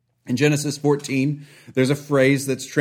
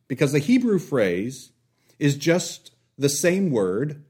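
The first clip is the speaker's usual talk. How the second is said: usual speaking voice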